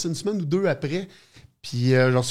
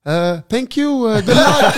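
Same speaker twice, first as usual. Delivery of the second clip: much higher and louder, slow, laughing through it; laughing mock thanks; 10 semitones higher, 8 dB louder, slower than usual. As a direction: brush off the GM with a cheeky laugh. Hangs up on the GM, retold.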